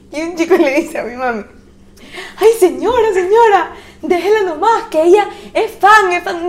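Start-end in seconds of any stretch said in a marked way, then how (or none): 2.4-6.5 higher-pitched pleading, lightly acted; pleading young fan. Boy fan appealing to a protective mother, pleading. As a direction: plead politely in a raised voice.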